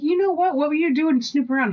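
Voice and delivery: high-pitched accusing retort; mock-outraged challenge in a high voice; indignant comeback. Retells caught-out quarrel over marshmallows.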